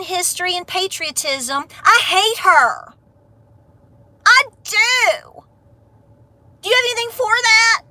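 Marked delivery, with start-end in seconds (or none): 1.8-7.8 mocking a hater. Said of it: "That's what these people are like".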